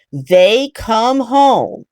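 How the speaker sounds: ordinary delivery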